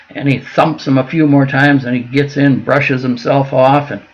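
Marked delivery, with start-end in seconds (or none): none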